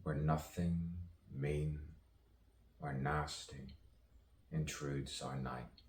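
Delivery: measured poetic recital with long pauses; spoken-word poetry. Acted. Poet musing aloud on misunderstanding and rebirth, spoken-word delivery. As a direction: recite it as free verse, letting each phrase hang.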